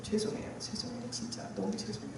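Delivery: whispered tearful sorry; tearful apology. Retold or imitated. Repenting to God in tears, whispered sobbing apology. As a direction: breathe the apology out, near tears. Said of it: "오열을 했어요".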